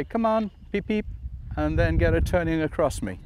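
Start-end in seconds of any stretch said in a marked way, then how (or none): 0.0-1.1 whistle beeps spoken. Shown as a demonstration. Trainer showing a whistle cue to the dog, soft spoken beeps. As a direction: come on then beeps like a whistle. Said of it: "I can say".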